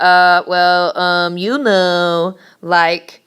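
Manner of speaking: slow, exaggerated hemming and hawing; filler words. Shown as a demonstration. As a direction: stall for time with dragged-out fillers. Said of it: "Don't do the".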